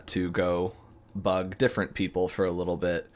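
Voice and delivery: usual speaking voice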